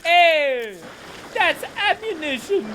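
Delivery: loud, high excited shout; thrilled to find ammo. Real, not acted. Spotting ammo in game.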